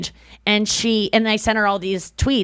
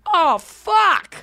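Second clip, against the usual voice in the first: touched exclamation; 9 semitones higher than usual. Retold or imitated. Moved by girlfriend's sweet words.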